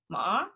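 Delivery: "ma" rising at the end like a question; second-tone rise. Shown as a demonstration. Showing Mandarin second tone, "ma" rising like a question. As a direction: lift "ma" upward, as if asking. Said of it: "would go up at the end".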